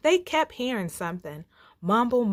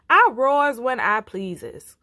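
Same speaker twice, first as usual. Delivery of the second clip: defiant lion; 4 semitones higher, 7 dB louder than usual. Acted.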